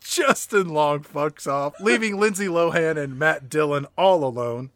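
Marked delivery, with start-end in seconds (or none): none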